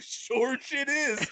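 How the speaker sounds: high, slowly drawn-out confirmation; retold gleeful admission. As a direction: own up to it with comic relish. Proudly owns up on surveillance video.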